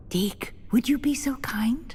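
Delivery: hushed, breathy request to the elf; whisper. Acted. Professor whispering a polite request to Deke. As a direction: whisper the favour so no one else hears.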